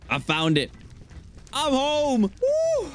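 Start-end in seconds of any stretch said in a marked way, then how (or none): 1.5-2.9 high, relieved whoop; triumphant cheer. Real, not acted. Gamer reaching home safe, high triumphant whoop. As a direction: cheer like you made it home alive. Safe return after near death.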